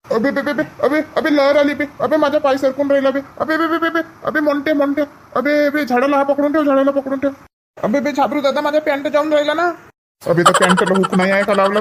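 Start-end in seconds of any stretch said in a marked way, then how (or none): 0.1-9.7 much higher, frantic stuttered cries; panicked stammering. Acted. Boy slipping in the flood cries out to his friend in panic. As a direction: panic as your foot slips in the current.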